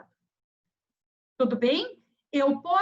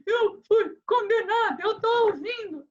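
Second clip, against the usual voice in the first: higher, alarmed voice for the convicted woman; imitating the frightened defendant; 8 semitones higher than usual. Defendant telling the lawyer she heard the verdict, mock alarm. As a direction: voice her panic, pitched up. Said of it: "a ré virou pra mim e falou assim".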